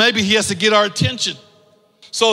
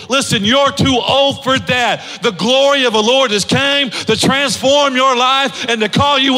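preaching at full force; 4 semitones higher than usual. Acted. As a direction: lift the church up, calling them higher.

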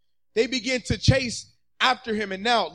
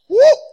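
sudden high, loud whoop; excited whoop; 13 semitones higher, 12 dB louder than usual. Real, not acted. Preacher lets out excited whoop.

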